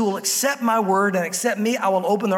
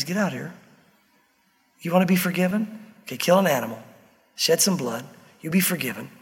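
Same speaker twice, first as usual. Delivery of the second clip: low, gentle, patient reassurance; God, fatherly; 6 semitones lower than usual. Retold or imitated. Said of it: "It was a fatherly thing".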